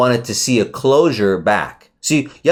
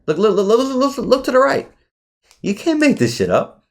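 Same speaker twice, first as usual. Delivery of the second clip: much higher, eager repeated look; thrilled, high-pitched amazement at the chart; amazed; 13 semitones higher than usual. Real, not acted. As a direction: point at it in disbelief. Price hits target, amazed.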